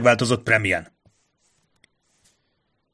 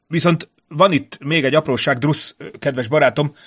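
lightly voiced higher-pitched diplomat; hesitant envoy; 5 semitones higher than usual. A narrator's reading. Envoy raising a small matter with Drusz, lightly ingratiating. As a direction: lightly play an envoy broaching a delicate matter.

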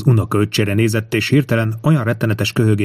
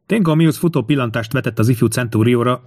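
ordinary delivery, nothing marked

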